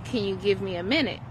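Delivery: even, patient ask, a bit quick; the calm way: give me a minute; calm request. Shown as a demonstration. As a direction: parent on the phone answering the kid calmly.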